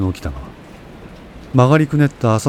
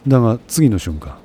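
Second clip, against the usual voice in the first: plain reading voice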